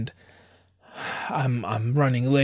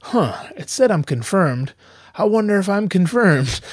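higher, louder mock wondering; exaggerated, ridiculing puzzlement; mock doubting asshole; 7 semitones higher, 4 dB louder than usual. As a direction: imitate someone pointlessly second-guessing. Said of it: "I'd be an asshole to be like".

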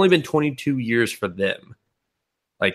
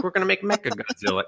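mock alien voice; 9 semitones higher, 4 dB quieter, faster than usual. Voicing aliens in movie recap.

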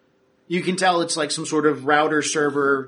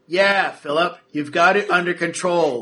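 mock reassurance; 3 semitones higher, 5 dB louder than usual. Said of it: "Terrence sits there and goes".

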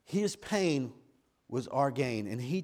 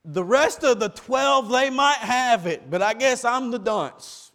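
loud, much higher plea of a confused disciple; preacher voicing Thomas at full force; Thomas confessing; 12 semitones higher, 12 dB louder than usual. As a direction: cry out that you're the dunce. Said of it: "do like Thomas and say".

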